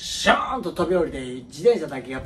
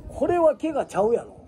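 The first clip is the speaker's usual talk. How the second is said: father's retort; 9 semitones higher than usual. Retold or imitated.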